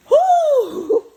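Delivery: loud, high whoop; loud high woo. Real, not acted. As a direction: whoop at the rush. Menthol steam hits hard.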